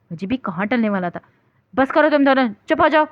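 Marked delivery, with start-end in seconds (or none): 1.8-3.0 loud, sharp order to stop; raised voice telling two women to stop; stern shout. Acted. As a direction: shout them both down. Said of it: "تایا جان نے دونوں کو گھورا".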